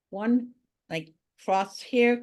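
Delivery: ordinary delivery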